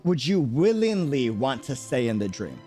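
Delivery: usual speaking voice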